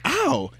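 high-pitched mock "ow" of pain; retold yelp. Telling friend about finding the sore spot, retold pained yelp. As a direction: let out a small, high yelp.

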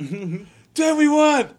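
high, loud whoop of a win; excited shout. Retold or imitated. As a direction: yell the win to your buddy. Winning at roulette.

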